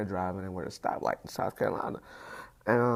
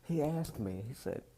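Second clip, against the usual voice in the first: ordinary talk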